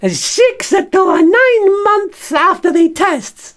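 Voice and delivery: very high, slow squeaky puppet voice playing the surgeon; Bert the stoma bag as the surgeon. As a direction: squeak it out like a little puppet doctor. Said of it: "can you be the surgeon for me".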